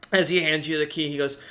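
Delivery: ordinary talk, nothing marked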